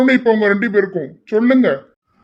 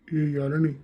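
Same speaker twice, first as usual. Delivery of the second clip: soft and lower, near her ear; hushed teasing; 3 semitones lower, 9 dB quieter than usual. Acted. Husband quietly teasing his wife beside the family, hushed. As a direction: keep it down so the family can't hear.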